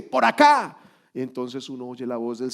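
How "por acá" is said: commanding call. Pretend. The Lord's answer, voiced.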